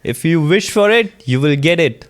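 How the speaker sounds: mock motivational speech; hammed-up inspirational coach; motivational speaker. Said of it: "Is this a motivational podcast now?".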